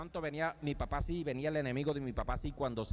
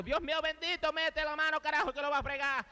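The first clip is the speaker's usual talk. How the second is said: high, urgent cry for help; high alarmed yell; panicked plea; 10 semitones higher than usual. Retold or imitated. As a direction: beg someone to step in, high. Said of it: "Y yo".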